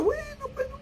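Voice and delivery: very high, thin mocking voice. A harsh imagined preacher scolding the sinner, high and mocking. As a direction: scoff it up high.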